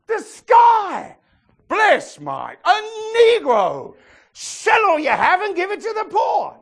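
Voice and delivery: high loud fervent exclaim; fervent preaching. Real, not acted. Preacher drives home his point, high loud fervent. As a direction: preach it with full excitement. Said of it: "You see what I'm saying?".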